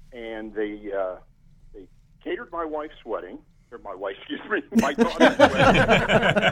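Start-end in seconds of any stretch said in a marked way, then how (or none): 4.2-6.5 laughing at own slip of the tongue. Real, not acted. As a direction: laugh through the words, high and loud.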